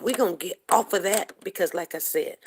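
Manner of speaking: ordinary talk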